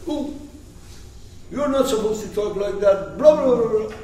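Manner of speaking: high-pitched mild scolding; mock-angry Moses. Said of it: "And Moses was angry".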